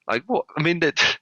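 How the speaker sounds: high-pitched comic disbelief; incredulous. Acted. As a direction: voice shoots up in mock astonishment at her cluelessness. Acts out disbelief at client.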